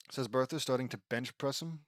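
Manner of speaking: usual speaking voice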